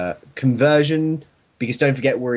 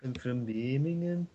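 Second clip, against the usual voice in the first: put-on Birmingham accent; 12 dB quieter than usual. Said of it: "sounds more like Birmingham".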